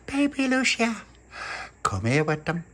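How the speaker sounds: high, slow doting coo; cooing at a baby. Acted. Little pappy cooing at baby Lucia, high and drawn out. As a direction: gush over the baby's cheeks. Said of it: "little puppy went".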